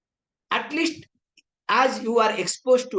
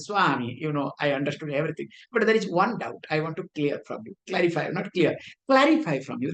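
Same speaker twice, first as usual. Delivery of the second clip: put-on light Western accent, quick and low; a lightly done foreign accent, hurried; imitating a Western professor's accent; 7 semitones lower, faster than usual. Visiting Western professor asks the Swami about a doubt, in a mild Western accent. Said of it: "because in his accent".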